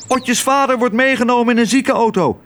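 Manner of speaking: high, urgent bird cry; starling calling out. A narrator's reading. Starling passes the alarm to a swallow, high calling. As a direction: shout the news in a high bird voice. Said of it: "riep de spreeuw".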